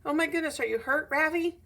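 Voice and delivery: high, fussing teacher concern. Acted. Teacher rushing to the fallen boy, high and worried. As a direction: rush over, worried and bright.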